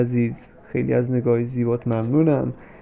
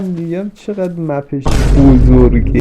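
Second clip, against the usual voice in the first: loud, slow and emphatic; loud exclaim; 10 dB louder, slower than usual. Real, not acted. Gamer reacting to the Isfahan map, loud and slow. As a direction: drag it out loudly, half joking. Excited outburst while playing.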